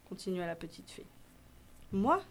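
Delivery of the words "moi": s'exclamer en garçon surpris; exclamation étonnée. A narrator's reading. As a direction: question brève, un peu forte. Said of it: "s'exclama Valentin". (exclamation de surprise)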